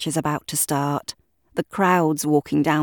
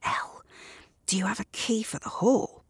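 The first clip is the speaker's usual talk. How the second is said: whispered, much softer; low voice; 4 semitones higher, 8 dB quieter than usual. Acted. Gavin asks Elle quietly for the hall key, whispering. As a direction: keep it hushed so only Elle hears. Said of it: "He says in a low voice".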